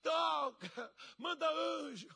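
high, mock wailing plea to God; crying prayer. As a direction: stretch each plea into a hammed-up wail. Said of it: "aí fica lá chorando".

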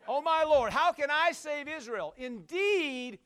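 loud, high plea to the Lord; acted doubting protest. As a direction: protest loudly, playing the reluctant hero. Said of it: "he's talking like this".

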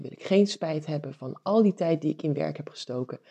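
ordinary talk

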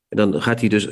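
usual speaking voice

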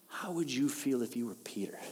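hushed whispered question; preacher whispering to the congregation. Real, not acted. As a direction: lean in and whisper it.

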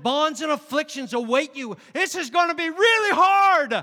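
rising to a loud prophetic shout, high and forceful; a booming prophet proclaiming hard times ahead; prophetic warning. Acted. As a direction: thunder the warning like a prophet at a prayer meeting. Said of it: "The Lord said".

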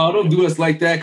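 usual speaking voice

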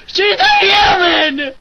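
hammed-up tearful rant; Chris Crocker. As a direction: cry it out, climbing to a slow, high shout. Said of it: "uh, Chris Crocker".

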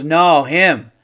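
loud, slow, emphatic; imitating staff lady. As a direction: drag out "him" slowly. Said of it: "She goes".

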